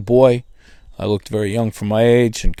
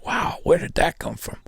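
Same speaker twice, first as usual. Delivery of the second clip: whispered amazement; 6 semitones higher, 10 dB quieter than usual. Retold or imitated. Inner thought, amazed at himself.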